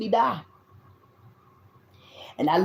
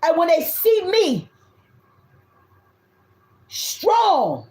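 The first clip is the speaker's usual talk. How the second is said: fiery preaching; 8 semitones higher than usual. Acted. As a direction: preach it out like a spiritual reading. Preaching reading to viewers.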